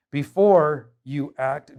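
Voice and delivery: ordinary delivery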